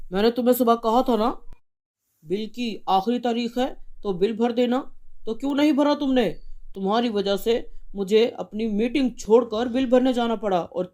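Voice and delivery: a husband furious about the fine; angry father. Acted.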